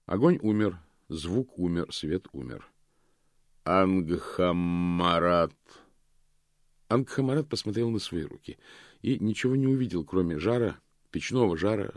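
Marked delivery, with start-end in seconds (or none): 3.6-5.5 slow, booming voice of Death; гулкий голос Смерти. A narrator's reading. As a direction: call the name slowly and hollowly. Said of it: "повторил гулкий голос". (гулкий, медленный зов Смерти)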